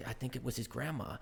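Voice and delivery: put-on soft American-accented recital. Actor recalls Spider-Man's line, soft American accent. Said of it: "incredible American accent".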